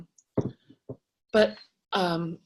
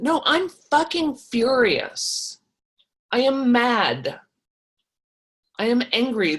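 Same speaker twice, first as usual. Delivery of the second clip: emphatic, higher-pitched acted fury; owned anger; 5 semitones higher, 4 dB louder, slower than usual. Coach modelling owning anger instead of minimizing it, emphatically furious. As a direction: declare the anger outright, stressing each statement. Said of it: "Am I angry?".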